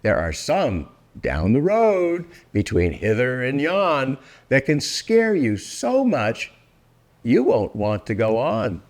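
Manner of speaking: plain reading voice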